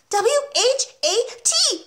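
very high, slow letter-by-letter squeal; little boy's high yelp of letters. A narrator's reading. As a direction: squeal out the spelling. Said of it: "he squealed".

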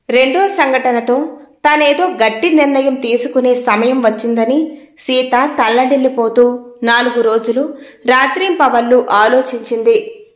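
ordinary delivery

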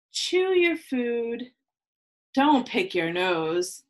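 measured rhyming read-aloud with pauses; reading to children. Acted. Teacher reading a picture book to her class, storytelling. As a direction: read each rule out to the kids, lingering.

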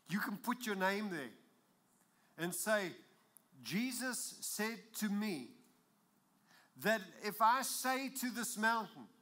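fiery preacher voice; full-force preaching. Acted. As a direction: proclaim it to the whole congregation. Preaching the promise.

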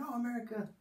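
very high, put-on "ooh" reaction; mock-impressed Italian youths. Imitating youths' reaction to America.

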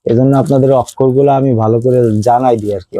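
ordinary delivery

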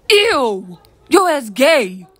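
high, loud recoil in disgust; a loud, drawn-out cry of disgust; disgusted outburst. Acted. Recoiling from a friend wiping face.

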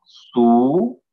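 "Subo" in stretched, separate syllables; syllable separation. Shown as a demonstration. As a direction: split "Subo" into su and bo, drawn out. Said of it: "Entonces se separa así".

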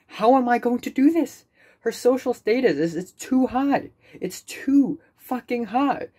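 high, anxious wail; real anxious whine; panicked whine. Real, not acted. Panicking about her status.

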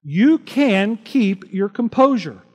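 ordinary delivery

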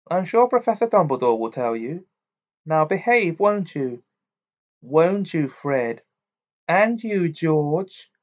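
a hint of mum's parting warning; Mrs Weasley.

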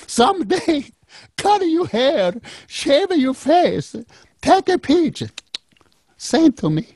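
high, slow pleading granny; grandmother voice. Retold or imitated. Grandma asking grandson for a shaved photo, high granny plea. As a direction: ask sweetly in a high old-lady voice.

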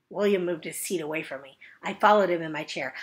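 ordinary delivery

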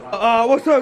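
ordinary talk

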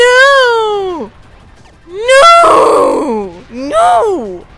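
high, loud wailing no; whining shouts. Real, not acted. Gamer wailing as the screen goes red, loud whining. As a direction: cry out in real frustration. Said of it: "Why am I whining so much".

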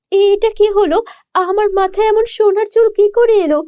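put-on high, amazed young girl; girl stunned by her sudden golden hair, high and amazed; astonished girl. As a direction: gasp out the question in disbelief.

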